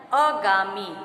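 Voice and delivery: अगामी loud, with short first vowel, "a-gaamee"; mispronunciation. Shown as a demonstration. Teacher announcing the next word, wrong short first vowel. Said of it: "जबकि शुद्ध उच्चारण इसका है".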